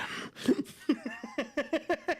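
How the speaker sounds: high staccato giggle; laughing. Real, not acted. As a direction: giggle helplessly in quick pulses. Cracks up after growling bit.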